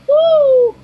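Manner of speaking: high, loud "woo"; whoop. Real, not acted. Whoops during the live game.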